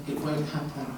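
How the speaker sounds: ordinary delivery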